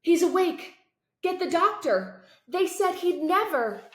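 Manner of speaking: high, loud, thrilled voices calling out; excited shouting. Acted. Relatives cry out as Chase wakes, high and loud with excitement. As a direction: burst out with joy at the bedside. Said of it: "You can't mistake the excitement".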